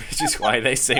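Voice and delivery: ordinary talk, nothing marked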